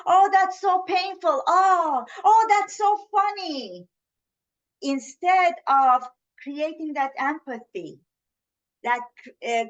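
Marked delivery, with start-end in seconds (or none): 0.0-3.9 gushing oh, oh, higher and louder; mock sympathy. Shown as a demonstration. Showing what sympathy sounds like, hammed-up gushing. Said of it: "So it's not sympathy".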